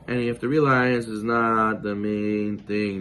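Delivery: usual speaking voice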